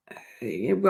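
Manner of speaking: speaking through a yawn; stifling a sudden yawn. Real, not acted. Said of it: "Feeling, uh, yawning all of a sudden".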